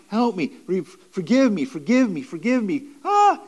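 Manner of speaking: high, pleading wail in imitation; mock crying out. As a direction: act out begging for forgiveness, high-pitched. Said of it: "Crying out, crying".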